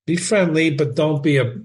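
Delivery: usual speaking voice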